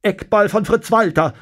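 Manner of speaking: high, loud, keyed-up reporter; tense commentary. A narrator's reading. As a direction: call the play with tension. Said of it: "ruft der Reporter angespannt".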